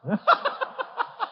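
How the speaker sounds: squealing high laugh; high giggle. Retold or imitated. Child giggling at the father's question. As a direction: giggle up high like a delighted kid.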